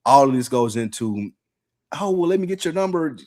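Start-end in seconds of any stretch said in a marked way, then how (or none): 2.0-3.2 higher, light imitation of her; high woman voice. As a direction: say it as the girl, lightly. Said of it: "Her saying that".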